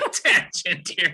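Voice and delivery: slow words broken by laughter; laughing. Real, not acted. Cracking up at his own breakfast tangent, laughing. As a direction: laugh through the words as you finish.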